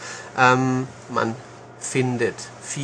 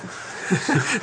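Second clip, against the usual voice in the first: laugh; 7 semitones higher than usual. Real, not acted. Laughs at co-host's teasing.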